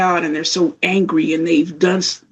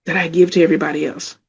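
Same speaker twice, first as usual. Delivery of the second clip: normal talking voice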